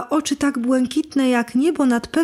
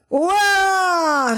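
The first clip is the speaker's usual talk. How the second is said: roar; 6 semitones higher than usual. Acted. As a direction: roar it out like a wicked fairy. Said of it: "ryknęła". (wściekły ryk wiedźmy)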